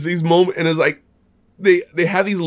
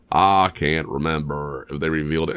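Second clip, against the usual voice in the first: hammed-up forgetfulness; 11 semitones lower, 5 dB quieter than usual. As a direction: drop low and stretch it out in mock effort. Hammed-up repeat while trying to recall.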